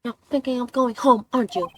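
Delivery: put-on bubbly teen heroine, eager to go; excited girl voice. Streamer voices Kay in game.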